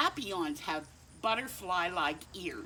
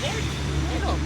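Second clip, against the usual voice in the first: dad's voice; 12 semitones higher, 10 dB louder than usual. Acted. Dad's voice in story.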